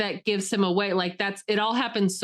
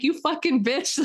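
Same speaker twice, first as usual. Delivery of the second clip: higher, a hammed-up insult; mock snarl; 7 semitones higher than usual. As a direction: spit it out like the trapped girl. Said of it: "She's like".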